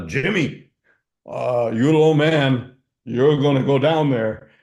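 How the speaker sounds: mock German accent of a boss sending the teenager down. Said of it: "in his German accent".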